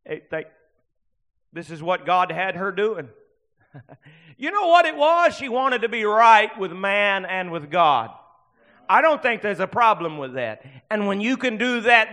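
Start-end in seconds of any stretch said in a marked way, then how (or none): 4.4-12.1 loud preacher's cry, starting very high; impassioned pulpit shout; full-force preaching. Real, not acted. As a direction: burst out and drive the point home.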